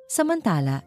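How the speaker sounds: plain reading voice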